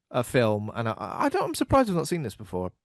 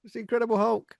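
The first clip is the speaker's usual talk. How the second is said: mock panic; 7 semitones higher than usual. Mock panic at sighting the Hulk.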